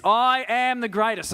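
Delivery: Muhammad Ali boast. Retold or imitated. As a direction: belt it out like a boxer crowing over a win. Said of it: "I can't do it in his accent".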